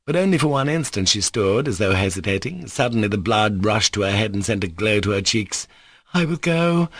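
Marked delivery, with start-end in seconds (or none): 6.1-6.9 cry. A narrator's reading. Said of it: "she cried".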